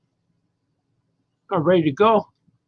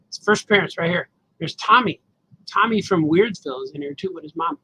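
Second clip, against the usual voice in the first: normal talking voice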